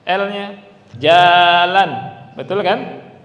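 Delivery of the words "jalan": jalan with the l drawn out; loud Jalan, holding the l long; long lam. Shown as a demonstration. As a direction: stretch the l sound in Jalan. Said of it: "Antum mau panjangkan lamnya juga boleh".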